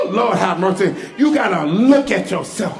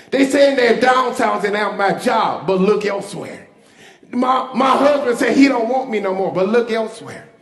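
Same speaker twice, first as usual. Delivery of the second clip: normal talking voice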